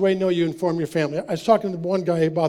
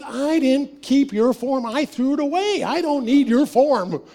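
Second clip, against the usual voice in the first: higher, slow, dismissive brother; a hint of a smug brother; 6 semitones higher, slower than usual. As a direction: brush him off like the brother did.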